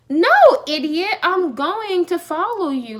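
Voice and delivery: loud, high retort, then slow and emphatic; acted-out fed-up comeback; exasperated correction. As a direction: retell it like spelling it out for a fool. Retold quarrel, insulting correction.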